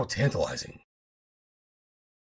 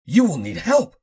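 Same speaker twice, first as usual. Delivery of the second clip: higher, louder pleading plea; 13 semitones higher, 9 dB louder than usual. Acted. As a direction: beg him for his trust. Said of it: "in a pleading tone".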